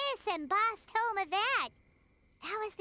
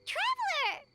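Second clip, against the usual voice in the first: high anxious call; urgent repeated call, much higher; 9 semitones higher than usual. Acted. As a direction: shout their name to wake them. Calling to unconscious companion.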